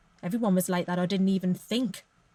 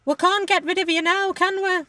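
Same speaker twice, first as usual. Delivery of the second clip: much higher, louder put-on voice; sighing senior colleague; 10 semitones higher, 5 dB louder than usual. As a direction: imitate the senior colleague, pitched right up. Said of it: "just sighed and said".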